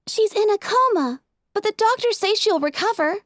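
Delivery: high, hesitant filly voice; breaking bad news gently; regretful. A narrator's reading. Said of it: "told her sister regretfully".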